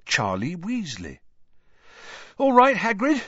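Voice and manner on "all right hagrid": high, loud, breathless greeting; panting. A narrator's reading. Charlie greeting Hagrid, out of breath and loud. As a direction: pant it out after the struggle. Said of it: "he panted".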